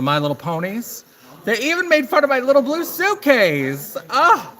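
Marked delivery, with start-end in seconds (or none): 1.4-4.5 high, loud drama-queen indignation; hammed-up outrage, pitched way up; mock outrage. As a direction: wail it like the final, unforgivable insult. Acted outrage over childhood teasing.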